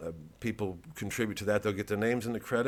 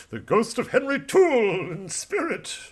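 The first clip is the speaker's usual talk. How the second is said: spooky ghost; 12 semitones higher, 5 dB louder than usual. Pretend. Ghostly voice of Henry in spirit.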